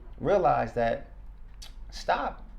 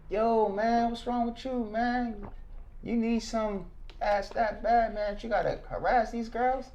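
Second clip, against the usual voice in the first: higher mock voice of a jeering stranger; a hint of a sneering driver; imitating taunting driver; 8 semitones higher than usual. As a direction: toss the insult out the car window. Driver insulting him from car.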